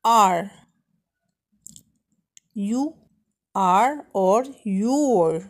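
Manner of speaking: usual speaking voice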